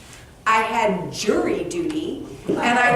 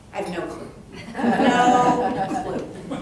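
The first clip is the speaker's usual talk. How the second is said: laughing; much slower than usual. Real, not acted. Laughing admission.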